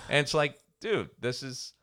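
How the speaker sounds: ordinary talk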